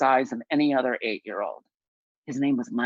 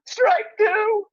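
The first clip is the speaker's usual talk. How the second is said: a piercing, much higher and louder "Strike!"; umpire's call; 5 dB louder than usual. Retold or imitated. A boy umpire calling the first pitch, a loud squeaky yell. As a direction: belt it out like a teenage ump whose voice is cracking. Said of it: "calls out the umpire".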